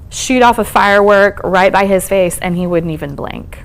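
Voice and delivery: ordinary talk, nothing marked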